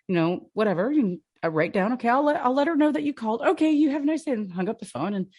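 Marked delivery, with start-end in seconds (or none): 1.9-4.3 high, quick sing-song goodbye; high polite sign-off. Retold or imitated. Student ending the call with a stranger, high and chirpy. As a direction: chirp a cheerful phone goodbye.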